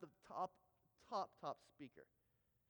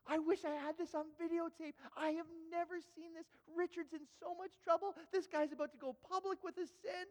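high-pitched mock disbelief; scandalized onlooker; 8 semitones higher than usual. Listener at the back thrills at a coming confession, scandalized. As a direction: gawk aloud at a juicy scandal. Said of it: "I'm at the back going".

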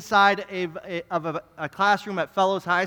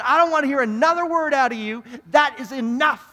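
higher, louder stern rebuke; snapping in anger; 5 semitones higher, 5 dB louder than usual. Retold or imitated. Pastor snapping at a student in front of class, loud stern rebuke. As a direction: shut him down, enough is enough. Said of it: "but I snapped and I said".

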